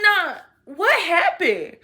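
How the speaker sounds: high, loud, drawn-out disbelief; retold losing it. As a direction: stretch it out in loud, hammed-up shock. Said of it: "I literally lost my marbles".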